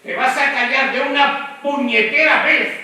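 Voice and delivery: enraged demand to shut up; angry shout. Shown as a demonstration. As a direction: hammer the word vez at Lorenzo. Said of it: "Eso sería acción verbal".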